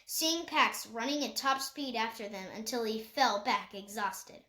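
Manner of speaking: plain reading voice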